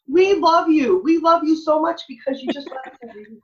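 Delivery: put-on high, loud gushing kids' voice; excited children's chorus, imitated; gushing kids. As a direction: voice the kids' delight, high and bright. Kids' gushing quote retold.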